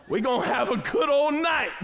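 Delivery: high, loud carefree whoop; gleeful. Retold or imitated. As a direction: celebrate like nothing is wrong. Retold excited plan with wife.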